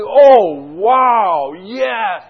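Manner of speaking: loud, drawn-out fake amazement; mocking God's delight. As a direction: exaggerate the rapture sarcastically. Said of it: "you're making a mockery of this".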